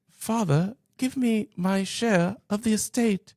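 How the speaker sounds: high, slow mock British accent. Younger son asking his father for his inheritance, high British accent. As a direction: put on a high, drawn-out British son's voice. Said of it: "they have a British accent".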